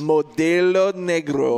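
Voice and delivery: slow, rolled Spanish "Modelo Negro"; hammed-up Spanish pronunciation of a beer name; mock Spanish accent. Mocking a friend's accent ordering beer, slow Spanish accent. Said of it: "I put an accent on it like you did".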